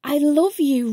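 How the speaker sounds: plain reading voice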